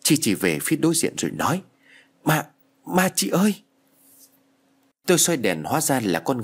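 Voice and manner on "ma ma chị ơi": high-pitched, stammered cry of fright; narrator's mild take on a panicked warning. A narrator's reading. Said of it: "tiếng kêu thất thanh của Chi".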